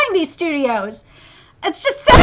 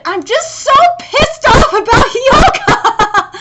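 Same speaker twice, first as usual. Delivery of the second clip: laughing frustration; 6 semitones higher, slower than usual. Real, not acted. Fan griping about Hyoga's scenes, laughing annoyed shout. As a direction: vent it while laughing at how bad it is.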